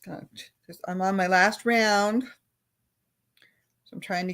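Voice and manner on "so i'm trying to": whisper. Real, not acted. Sudden whisper mid-sentence.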